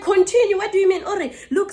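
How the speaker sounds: high, loud incredulous retort; indignant. Retold or imitated. Driver answering neighbour's accusation, indignant. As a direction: throw the word back in retold outrage.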